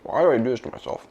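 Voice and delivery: talking through a chewy mouthful; chewing toffee. Real, not acted. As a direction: mouth full of sticky bar. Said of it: "It stuck to my teeth".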